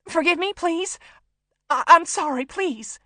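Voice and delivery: high, loud, panicked pleading; desperate plea for mercy; begging. A narrator's reading. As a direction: beg for forgiveness, stumbling over the words. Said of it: "begging him".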